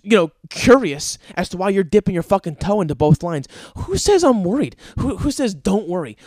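high, loud furious ranting; angry rant. Acted. Comedian rants at the guy in line, high and furious. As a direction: yell it, offended by being told not to worry.

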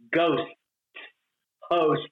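"ghost", "host" singly, final consonants crisply sounded; careful consonants. Shown as a demonstration. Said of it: "I had to learn consonants".

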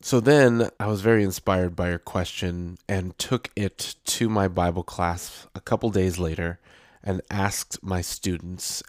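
usual speaking voice

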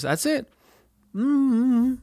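high squeaky panic; high pitched dread; panicked high voice. Retold or imitated. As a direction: squeak out the doom. Panicked inner thought.

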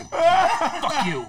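high, loud laughing fuck you; laughing mock curse. Actor imitates Rick cursing at Cisco, loud and laughing. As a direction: curse him out through laughter. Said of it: "He's like".

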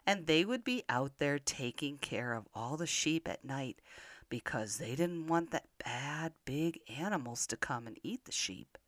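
ordinary delivery, nothing marked

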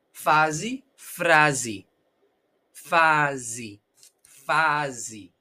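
"Fase" and "Frase" ending in -zi; final I. Shown as a demonstration. Teacher drilling "Fase" and "Frase", E as I. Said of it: "nosotros pronunciamos I".

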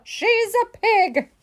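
high, slow, emphatic outburst; exasperated storybook yell; indignant shout. A narrator's reading. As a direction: hammer it out scornfully. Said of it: "shouted Eugenia".

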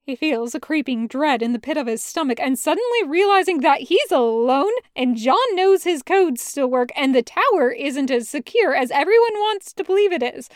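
usual speaking voice

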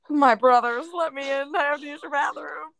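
high, loud put-on plea; mock frat plea. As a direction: wail it playfully, as a pretend frat brother. Listener joins the mock frat plea.

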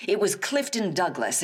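usual speaking voice

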